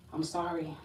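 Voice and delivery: ordinary talk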